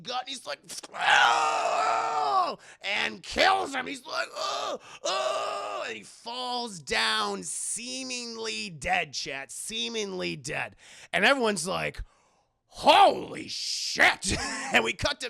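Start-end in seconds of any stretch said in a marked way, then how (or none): 0.9-2.6 long, high, loud death wail; hammed-up cry of pain; agonized cry. Villain impaled through the gut. 4.4-5.8 high, drawn-out pained moans; hammed-up death cries; dying groans. Villain dying. 12.7-14.4 loud, very drawn-out stunned exclamation; hammed-up crowd astonishment; awestruck shock.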